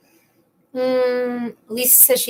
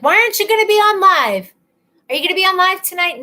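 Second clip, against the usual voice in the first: higher, insistent mom imitation; nagging mom; 8 semitones higher, 5 dB louder, faster than usual. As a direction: nag like an impatient mother. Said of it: "my mom's been like".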